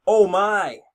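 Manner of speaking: Michael Cole. Retold or imitated. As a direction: cry out into the headset. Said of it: "Michael Cole screams out on the headset".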